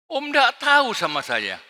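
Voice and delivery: loud, high, boastful shout; loud bragging with a high voice; arrogant rich man. Acted. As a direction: show off like a proud church builder. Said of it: "banyak orang kaya sombong".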